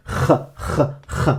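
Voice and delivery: soft repeated throat scrapes on "kh"; isolated kh sound. Shown as a demonstration. Teacher demonstrates the sound for learners, bare guttural "kh". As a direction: rasp "kh" at the back of the throat, quietly. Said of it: "vous savez prononcer ce son".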